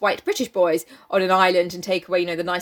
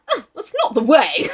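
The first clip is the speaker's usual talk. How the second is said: high, louder pained protest; mock yell; 6 semitones higher, 5 dB louder than usual. A narrator's reading. Sam protesting being prodded, mock yell. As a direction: yelp it as a hammed-up protest. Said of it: "Sam yelled".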